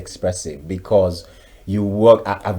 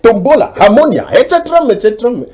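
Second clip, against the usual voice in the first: much higher and louder ranting Nigerian elder, breaking into laughs; mock outraged elder; 10 semitones higher, 7 dB louder than usual. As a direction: rant the list of diseases like an old man in a comic radio drama. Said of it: "And the guy will say".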